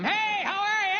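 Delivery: very high, over-bright greeting; smooth over the anger with forced cheer; hammed-up happy daddy hello; fake cheery cover-up. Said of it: "able to smooth it over really quickly".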